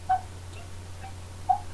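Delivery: tiny mouse count. Acted.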